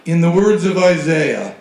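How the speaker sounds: loud, slow, solemn quote; prophetic proclamation. Acted. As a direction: declaim it slowly like a prophet. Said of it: "what I do is say".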